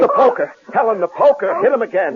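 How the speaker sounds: high, rushed urging; urgent command. Acted. Husband urging his wife to hit the attacker, urgent. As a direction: push her to strike now.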